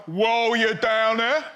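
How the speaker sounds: slow, loud leering catcall; mock sleazy catcall; leering bloke. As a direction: drag it out like a creepy bloke. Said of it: "some prick is always like".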